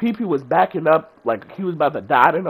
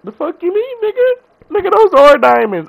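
mock outraged squeak, much higher, ending loud; imitating friend's outrage; 9 dB louder than usual. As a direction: play the friend as offended and squeaky. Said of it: "He was like".

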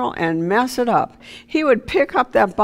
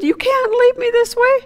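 much higher and louder, a begging cry; desperate pleading; 5 dB louder than usual. Retold or imitated. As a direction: beg her not to go, voice climbing high. Husband begging dead wife back.

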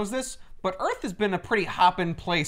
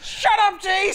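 mock angry mom; 13 semitones higher, 3 dB louder, slower than usual. Voicing the mom's outburst.

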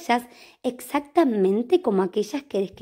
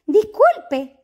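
polite cat voice; 10 semitones higher, 4 dB louder than usual. Acted. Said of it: "preguntó Horacio".

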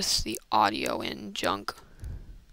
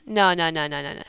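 ordinary talk

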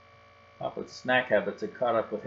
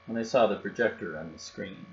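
ordinary delivery, nothing marked

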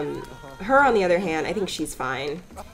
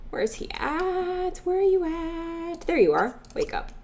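calling out playfully; 7 semitones higher, 3 dB quieter than usual. Acted. Player looking for her Sim, high singsong calling. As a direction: stretch it out softly as a searching call.

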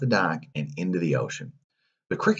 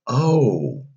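startled cry; 4 semitones higher than usual. Acted. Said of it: "cried the cricket".

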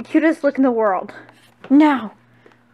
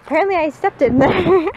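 higher, breaking into a laugh; giggly admission; amused; 4 semitones higher, 4 dB louder than usual. Real, not acted. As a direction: say it on the edge of laughter. Laughs at having stepped in it.

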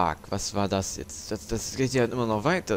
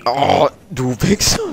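slow, loud furious shout; angry shout; 5 semitones higher, 11 dB louder, slower than usual. Real, not acted. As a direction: bellow the insult at the enemy. Enemy hits him, insults it.

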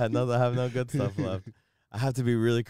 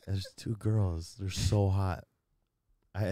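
ordinary delivery

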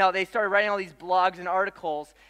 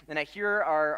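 ordinary talk

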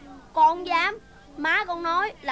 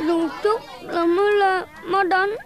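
slow, louder, crying complaint; tearful complaint; 4 dB louder than usual. Acted. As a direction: sob it out to the teacher. Child tells teacher about scolding.

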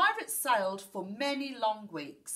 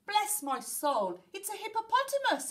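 astonished shout; 13 semitones higher, 5 dB louder than usual. A narrator's reading. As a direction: shout in amazement, climbing higher. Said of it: "he shouted".

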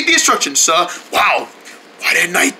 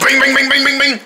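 mock fixing noises; 4 semitones higher than usual. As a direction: chant the fixing noises like a cartoon. Butler fixing sink noises.

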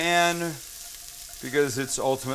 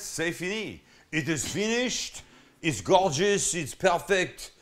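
put-on French accent; 3 semitones higher than usual. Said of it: "your terrible accent".

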